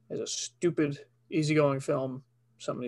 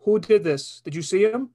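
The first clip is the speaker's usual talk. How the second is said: louder, urgent cop questions; urgent detective; 6 semitones higher, 5 dB louder than usual. Acted. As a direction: press the kid, urgent.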